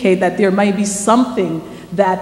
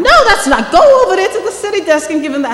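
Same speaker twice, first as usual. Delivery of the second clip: higher and louder, laughing through the words; laughing dismissal; 12 semitones higher, 7 dB louder than usual. Retold or imitated. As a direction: laugh her off and point elsewhere. Suburban editor laughing her off.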